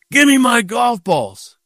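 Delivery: high, slow imitated demand; retold angry demand. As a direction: drag out the order as the intimidating golfer.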